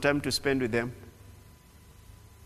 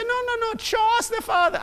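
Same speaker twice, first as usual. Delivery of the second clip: much higher mock pleading; mock disciple. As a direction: squeak it like a pestering disciple. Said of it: "I said".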